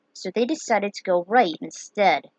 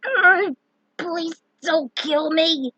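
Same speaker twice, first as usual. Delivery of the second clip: pleading; 8 semitones higher, 4 dB louder, much slower than usual. Acted. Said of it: "pleaded under his breath".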